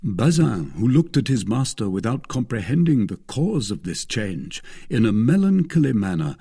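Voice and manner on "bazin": ordinary delivery, nothing marked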